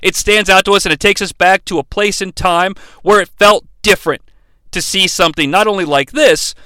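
usual speaking voice

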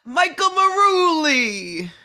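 loud, high, drawn-out name reveal; triumphant announcement. Pretend. Guest naming the season's winner to the host, triumphant announcement. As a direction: belt out the winner's name slowly. Answering who wins the season.

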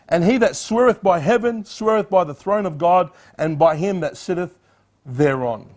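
loud, declaiming King James verse; scripture reading. Acted. Preacher reading Jesus' rebuke aloud, declaiming. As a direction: proclaim it from the pulpit.

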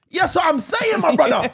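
high, loud street banter; hammed-up road-man greeting among old friends; slipping into road talk. Reunited with road friends, slipping into road talk. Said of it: "We slipped right back in".

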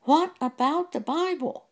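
mild high-pitched yell at the TV; exclaimed objection, high but not full volume; retold screaming. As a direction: re-enact a shouted protest, pitched high. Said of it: "I was screaming at the T V".

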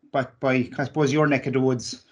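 ordinary talk

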